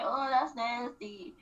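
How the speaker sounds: slow, put-on disgust at the food; mock a picky drunk date; disgusted girlfriend. Imitating Chrisean tasting food, mildly disgusted. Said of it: "She was like".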